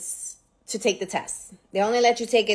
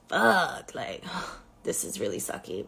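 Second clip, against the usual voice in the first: dejected inner groan; 9 semitones lower, 10 dB quieter than usual. Retold or imitated. Retold dejected thought, breathy.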